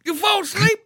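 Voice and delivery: put-on high, shouting southern old man; southern accent. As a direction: yell him awake, southern style. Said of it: "He had a southern accent".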